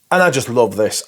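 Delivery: usual speaking voice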